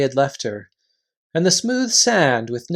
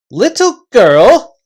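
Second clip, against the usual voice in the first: indignant; 9 semitones higher, 9 dB louder, slower than usual. Acted. Reepicheep offended at slight.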